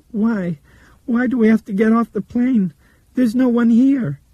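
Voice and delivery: put-on high, loud child protest; panicky child. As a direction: plead as a panicking little girl.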